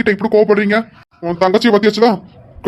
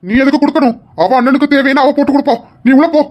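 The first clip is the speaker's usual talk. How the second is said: raised, higher voice ordering her inside; angry scolding; 6 semitones higher, 5 dB louder than usual. Acted. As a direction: snap at your sister to go in. Brother scolds sister for serving coffee. (கோபமாக உரத்த குரலில் திட்டுதல்)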